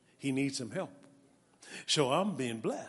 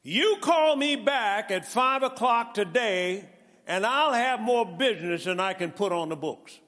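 bold declaration; 6 semitones higher, 7 dB louder than usual. Retold or imitated. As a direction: declare it with full faith. Bold faith declaration to sister.

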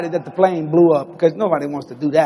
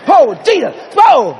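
higher and louder, worked up; excited retelling; 10 semitones higher, 6 dB louder, slower than usual. Retold or imitated. Preacher relives the plane evacuation, worked up and loud. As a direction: tell it like it's happening right now.

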